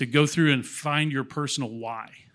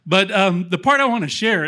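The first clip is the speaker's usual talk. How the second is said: choked up; 4 semitones higher, 6 dB louder than usual. Real, not acted. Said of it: "I can't believe I'm getting choked up".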